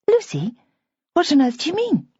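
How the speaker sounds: startled, rising high; shocked young woman; surprised. Acted. Bridget startled.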